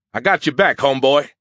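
cocky, high-energy reassurance; hearty support. Acted. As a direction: back your buddy up with gusto. Character cheerfully pledges his support.

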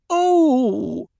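a high, loud little oh; a high startled oh; Harah's voice. A narrator's reading. Harah reacting to Stilgar's order, high and loud. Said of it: "she said".

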